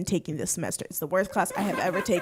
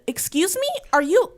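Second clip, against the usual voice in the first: shocked concern; 8 semitones higher than usual. Retold or imitated. Retelling her alarmed reply.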